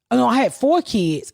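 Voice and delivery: ordinary delivery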